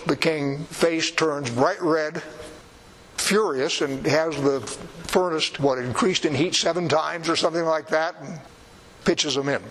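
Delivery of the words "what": ordinary talk, nothing marked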